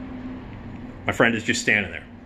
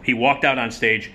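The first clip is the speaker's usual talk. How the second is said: normal talking voice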